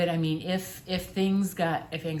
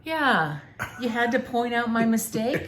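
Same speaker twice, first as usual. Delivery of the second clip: mock offended; 5 semitones higher, 5 dB louder than usual.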